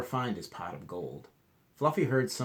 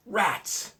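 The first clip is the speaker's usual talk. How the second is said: frustrated rats; 10 semitones higher, 7 dB louder than usual. Acted. Fluffy tricked again.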